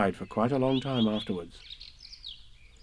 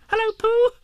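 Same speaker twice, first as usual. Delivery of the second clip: very high squeak; squeaky Roo; 6 dB louder than usual. Acted. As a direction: squeak it out, tiny and eager. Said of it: "he squeaked".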